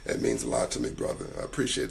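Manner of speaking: hoarse, worn-out voice; raspy voice. Real, not acted. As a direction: rasp it out, voice nearly gone. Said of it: "It makes my raspy voice worthwhile".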